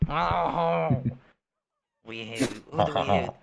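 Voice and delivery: a bad French chef accent played for laughs; shitty French accent. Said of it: "in my shitty fucking French accent".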